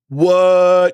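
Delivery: loud, high, stretched what; amazed what. Retold or imitated. Viewer stunned by the comic's influence, loud and high. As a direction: let the disbelief burst out. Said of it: "you're like".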